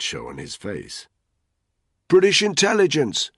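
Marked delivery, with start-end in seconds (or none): 2.1-3.3 loud, high shout over the rotors. A narrator's reading. Danny shouting to the Nigerians over the rotors, loud call. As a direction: announce yourself at full volume. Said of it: "he shouted".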